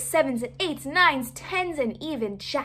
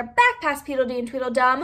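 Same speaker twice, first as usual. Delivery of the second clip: ordinary delivery, nothing marked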